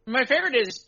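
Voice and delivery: ordinary talk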